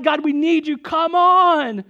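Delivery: loud, high impassioned cry; declaring out to God. Retold or imitated. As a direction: build to a drawn-out shouted plea. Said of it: "everybody's just declaring out to God".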